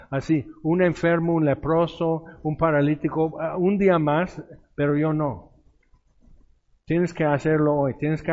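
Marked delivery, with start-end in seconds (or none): none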